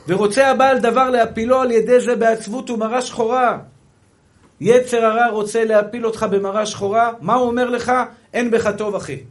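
forceful declamation to the congregation; full-force preaching. Acted. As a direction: drive the warning home at high volume.

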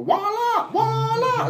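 very high, drawn-out sing-song; sing-song voila. Retold or imitated. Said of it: "where you can't just go".

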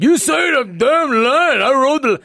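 Stallone shout. Retold or imitated. Stallone yelling at the actor again, slow angry shout. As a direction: roar the command.

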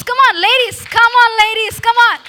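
loud, high urging call to the crowd; rally the ladies to clap; rousing call. Real, not acted. Urging the women to applaud.

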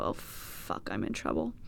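hushed, low sinking voice; very soft, defeated aside; retold dread. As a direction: mutter it low as the stomach drops. Retold dread as stomach drops.

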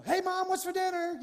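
high, sing-song hinting to mom; lightly voiced son fishing for dinner; mock innocent ask. Pastor mocking hinting to his mom, high sing-song. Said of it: "You know".

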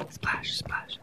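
breathy splash sound; whispered splash. Acted. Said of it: "do the splashes with me".

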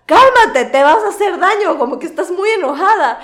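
high, loud mock plea; hammed-up frightened pleading; scared brother. Frightened brother begging her to calm down, high loud mock plea. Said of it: "el man se asustó".

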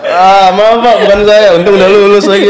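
high, loud, laughing plea; laughing mock apology. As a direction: beg forgiveness through laughter. Joking apology to absent lecturer.

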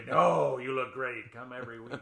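big emphatic no, then easy flattery; flattering barber. Acted. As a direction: gush like a barber keeping a customer. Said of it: "for them to say".

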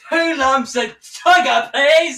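slow, high prim tea order; posh voice. Pretend. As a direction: order tea like a fussy aristocrat.

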